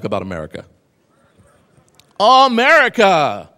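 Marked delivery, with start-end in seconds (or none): 2.2-3.5 loud, slow, drawn-out shout; booming proclamation. Acted. To the audience, announcing the topic with a loud slow proclamation. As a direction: proclaim it like a showman.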